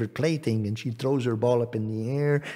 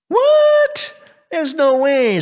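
very high, loud shriek of shock; acted princess shriek, appalled; outraged disbelief; 9 dB louder, slower than usual. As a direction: screech it in horrified refusal. Princess shocked by frog's demand.

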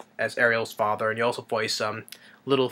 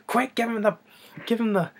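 much higher, choked urgent voice; panicked plea; 11 semitones higher than usual. Retold or imitated. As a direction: gasp it out while being strangled. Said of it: "It's like".

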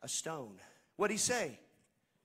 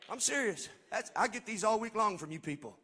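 ordinary delivery

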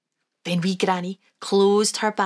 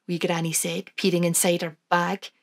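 ordinary delivery

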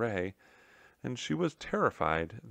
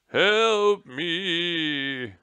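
high, loud, drawn-out wail; moaning cry for help; 9 semitones higher, 10 dB louder than usual. A narrator's reading. Trapped general calling down the chimney, moaning wail. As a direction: wail it out like a ghostly moan. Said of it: "the moaning started again".

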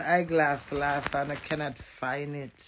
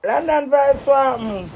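excited call out; 7 semitones higher, 7 dB louder than usual. Real, not acted. Calling out a greeting.

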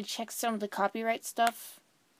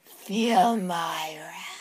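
slow, hushed villain threat; menacing whisper; 3 semitones lower, 3 dB louder, much slower than usual. Acted. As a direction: breathe the threat slowly.

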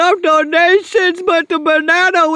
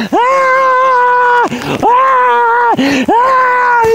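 mock terror at the banana witch; panicked yelling; 5 semitones higher than usual. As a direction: scream like something is chasing you. Streamer fleeing the banana witch.

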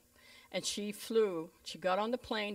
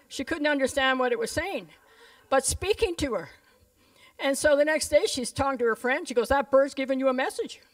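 rising louder and higher preacher climax; preaching at full force; 6 semitones higher, 10 dB louder than usual. Acted. Preacher telling the congregation about Bertie's message, building to a loud climax. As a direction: build it up and shout the name.

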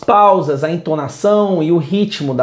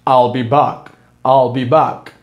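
Austrian accent; 7 semitones lower, slower than usual. Shown as a demonstration. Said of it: "Que é o sotaque, austríaco".

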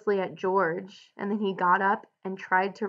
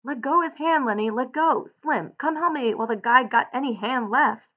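urgent, moderately raised shout; 4 semitones higher, 3 dB louder than usual. A narrator's reading. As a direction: call out repeatedly, starting high. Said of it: "George shouted over and over".

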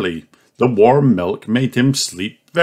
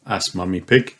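ordinary delivery

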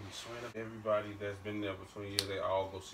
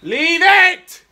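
high, loud shout at the game; gamer rage yell; 22 dB louder than usual. Real, not acted. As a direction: scream it out at the screen. Yelling at gaming screen.

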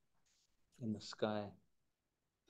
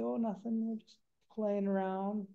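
put-on high, light little-girl voice; little girls' voice; 7 semitones higher than usual. Lice girls answering Coyote innocently, high little-girl voice. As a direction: sound like small innocent girls at play. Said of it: "they're little girls".

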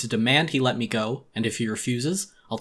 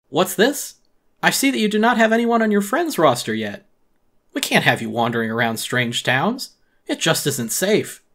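higher, louder smooth shopkeeper; smooth-talk the firing politely; oily raccoon boss laying him off; Tom Nook voice; 6 semitones higher, 6 dB louder than usual. Acted.

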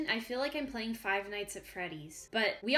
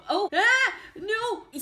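high, loud gasp and scream of fright; startled scream; 10 semitones higher, 10 dB louder, much slower than usual. Real, not acted. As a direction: jump out of your skin. Sudden attack in game.

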